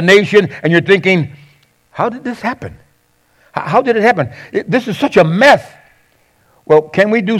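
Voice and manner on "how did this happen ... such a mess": higher-pitched, acted-out bewilderment; bewildered reaction. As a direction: voice a puzzled listener taking in a mess. Acts out listeners' bewildered reaction.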